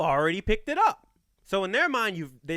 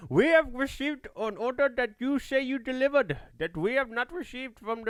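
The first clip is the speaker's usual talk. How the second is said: higher put-on Indian accent for a call-centre agent; mock Indian accent, customer-service greeting; 6 semitones higher than usual. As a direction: play an Indian support agent opening a call. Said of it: "I can only do an Indian guy accent".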